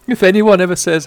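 normal talking voice

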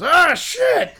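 frustrated curse. Retold or imitated. Fan walking out of the corral, loud frustrated curse. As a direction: spit it out as you walk off beaten. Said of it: "the best thing ... he goes".

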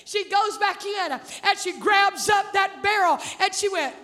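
high-pitched, driving sermon delivery; full-force preaching. Real, not acted. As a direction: preach the story with mounting excitement.

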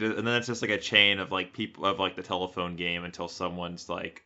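ordinary talk, nothing marked

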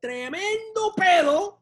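put-on high, slow drawn-out drunken boast; acted drunk. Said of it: "If you're really drunk".

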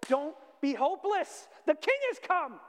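loud, high, ringing proclamation; preacher's shout. Real, not acted. Preacher rousing the hopeless congregation, shouting in triumph. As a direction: cry it out at full force.

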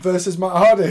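mock announcer. Announcer-style match intro.

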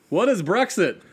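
high, loud mocking question; hammed-up cluelessness; mock clueless Brit. Mocks clueless British searchers after voting.

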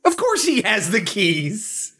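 high, slow exasperation; drawn-out, incredulous protest; exasperated disbelief. Acted. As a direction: stretch it out high, stating the obvious. Mock outrage at the plot hole.

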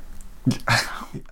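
quick breathy chuckle; a brief amused laugh under the breath; short breathy laugh. Real, not acted. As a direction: laugh softly at the joke. GM laughs at player line.

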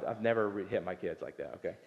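usual speaking voice

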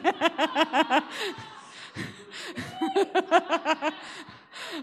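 long, high cackling laugh; real burst of laughter; hearty laugh. Real, not acted. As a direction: laugh long and high at your own joke. Laughing at own joke.

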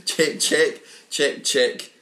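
measured, repetitive chant with each word set apart; mock poetry recital. Host reading his unfinished egg sonnet to friends, mock recital. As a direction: recite it like a solemn nonsense poem.

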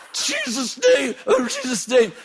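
very high, slow shouted cry; shouted rebuke. Retold or imitated. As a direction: cry out desperately while struggling. Preacher crying out in struggle.

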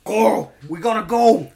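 crazed Southern holler; urgent, overdone Southern impression; Rick Grimes. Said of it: "going crazy and stuff".

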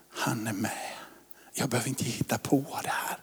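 soft unvoiced whisper; whispered reassurance; prayerful whisper. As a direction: hush it like a prayer at bedside.

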